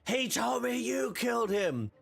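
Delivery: hammed-up young hero protest; Luke. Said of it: "he said".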